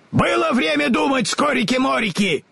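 very high, angry scarecrow; furious yell. A narrator's reading. Enraged Scarecrow shouts at the wizard. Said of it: "заорал разъярённый Страшила".

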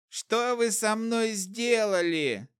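a poisoned trader coming round; hoarse voice. A narrator's reading. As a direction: croak it out just after waking. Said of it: "осипшим голосом спросил Шнобель".

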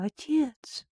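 faint, high trapped cry; weak call for help. Acted. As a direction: call out weakly from under the weight. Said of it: "слабо позвала".

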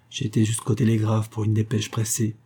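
ordinary delivery, nothing marked

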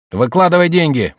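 high, loud robber's demand; commanding. Acted. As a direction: order the victim sharply to obey. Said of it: "приказал второй". (громкий приказ грабителя)

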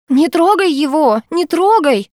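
high, slow hysterical shriek; hysterical scream. A narrator's reading. Lena screaming at Bogdan to leave Gena, hysterical. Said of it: "истерично вопила Лена".

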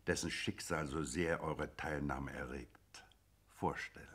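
whispering. Acted. Judge lowers voice ominously.

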